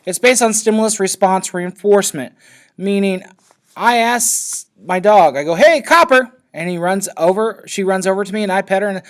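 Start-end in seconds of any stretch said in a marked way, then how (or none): 5.5-6.3 calling a dog by name, much higher and louder. Retold or imitated. Teacher calling her dog Copper, higher and louder. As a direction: call out brightly across the yard to the dog.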